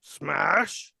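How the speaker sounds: high, loud battle cry; hero attack shout. Acted. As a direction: yell the attack name. Impostor shouts attack name.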